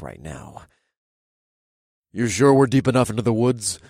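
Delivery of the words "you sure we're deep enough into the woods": deeper voice. Acted. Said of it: "a deeper voice asked".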